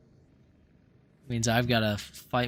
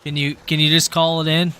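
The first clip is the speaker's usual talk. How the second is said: panicking gamer; 4 semitones higher, 8 dB louder than usual. Real, not acted. Downed, begging teammate for help.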